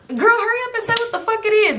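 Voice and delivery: high, impatient teasing push; impatient friend. Retold or imitated. Friend at the campfire urging Shoestring to spit it out, impatient. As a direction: rush her like a joking impatient buddy. Party hurries Shoestring at truth or dare.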